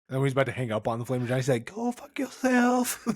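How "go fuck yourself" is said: high, slow put-on voice; drawn-out mock insult; mock Grossman line. Imitating Grossman hanging up.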